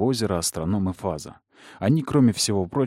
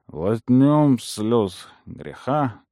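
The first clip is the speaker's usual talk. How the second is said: put-on slow, slurred drunken toast; drunk rambling toast; much slower than usual. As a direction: drag the words out drunk. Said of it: "заплетающимся языком".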